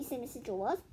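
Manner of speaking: plain reading voice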